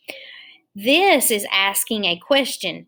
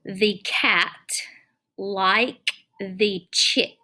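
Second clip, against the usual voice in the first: slow read-aloud; much slower than usual. Shown as a demonstration. Teacher reads sentence aloud slowly.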